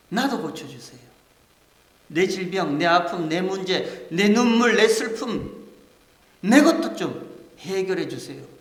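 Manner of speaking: pleading crowd. Acted. Crowd's plea voiced in sermon.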